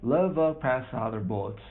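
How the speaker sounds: plain reading voice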